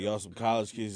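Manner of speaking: ordinary talk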